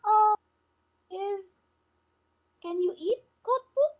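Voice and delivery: high squeaky goblin. Acted. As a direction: pitch up as a curious goblin. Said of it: "look at the tea that she has and ask you".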